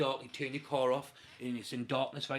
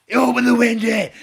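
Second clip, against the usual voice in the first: much louder, high bellow; furious shouted order; 8 semitones higher, 16 dB louder than usual. Retold or imitated. Man repeating his order to the stalled driver, bellowing. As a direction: bellow it at someone who didn't obey.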